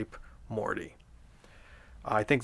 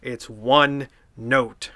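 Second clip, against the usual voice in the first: plain reading voice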